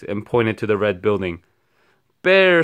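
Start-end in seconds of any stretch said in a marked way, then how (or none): 2.2-2.6 high loud alarmed yell; shout. A narrator's reading. Townsperson spotting the bear, loud shout. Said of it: "somebody shouted".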